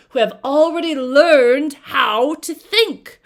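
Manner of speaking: slow, loud staccato ancient beast; Sphinx voice. Acted. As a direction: weigh every word like an old beast. Reads the Sphinx's lines.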